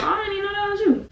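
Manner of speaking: high, fast, breezy fake innocence; mock casual. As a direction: toss it off like nothing happened. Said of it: "Just casually".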